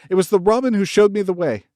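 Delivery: loud, high and fast; girl explaining urgently up the wall; breathless call. A narrator's reading. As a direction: gasp it up at him in a rush. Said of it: "called up to him with a sort of gasp".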